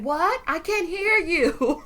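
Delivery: high, drawn-out mock hard-of-hearing call; calling back. [name] can't hear Kyle down the table, mock calling back.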